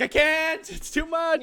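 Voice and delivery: overwhelmed whine. Retold or imitated. Refusing a friend's dumped problems, overwhelmed high whine. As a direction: squeal it like you're at your limit.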